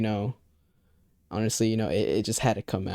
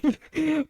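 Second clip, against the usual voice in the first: quick high burst of laughter; a sudden squeaky laugh at the memory; short high laugh. Real, not acted. Laughs at popcorn reading memory.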